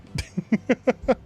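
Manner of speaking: high rising giggle; laugh. Real, not acted. Streamer giggling at the cat putting the dog in place.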